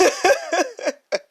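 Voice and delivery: short burst of laughter; high laugh. Real, not acted. Host laughs about her blunt grandmother, real laugh.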